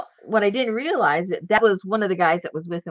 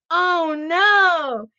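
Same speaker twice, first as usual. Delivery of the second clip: mock devastated; 11 semitones higher, 5 dB louder than usual. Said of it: "he was, like, all devastated".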